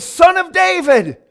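loud pleading cry of Son of David, rising high. Retold or imitated. Blind men calling out to Jesus, a loud plea. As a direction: call out to the passing king for mercy. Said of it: "And they said".

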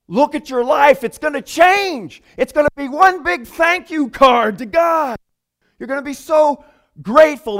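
fervent exhortation. Real, not acted. Pastor urging the congregation to thankful lives, fervent. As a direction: rouse the congregation to gratitude.

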